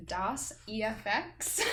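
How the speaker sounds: slow, German-sounding name; German accent. Real, not acted. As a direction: say it like a German would. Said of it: "I sound really, really German".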